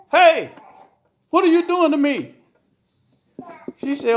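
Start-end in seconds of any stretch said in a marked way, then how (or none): 0.1-2.3 loud, high, indignant holler. A narrator's reading. Tied-up husband wakes and challenges his wife, hollering. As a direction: holler it in alarm. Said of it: "And he hollered".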